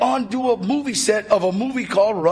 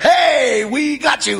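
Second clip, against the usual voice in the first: louder, higher gotcha call; spring the prank like a game-show host; a lightly voiced prank-show host; 5 semitones higher, 5 dB louder, slower than usual. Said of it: "T V host guy's like".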